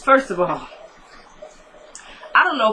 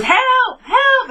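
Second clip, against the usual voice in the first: high-pitched mock cry for help; mock scream; 3 dB louder than usual. Woman threatens to scream as he takes the money, mock scream. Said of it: "I'm gonna scream".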